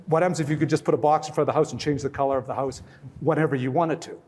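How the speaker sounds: normal talking voice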